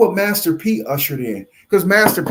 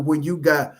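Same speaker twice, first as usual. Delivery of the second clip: normal talking voice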